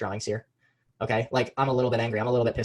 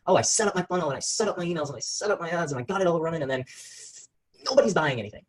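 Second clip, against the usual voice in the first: frustrated entrepreneur; 5 semitones higher than usual. Retold or imitated. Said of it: "where it's like".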